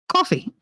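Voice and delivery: high "Coffee" in imitation of a small boy; son's coffee. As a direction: mimic the kid's "Coffee", pitched high. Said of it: "there's no W in coffee".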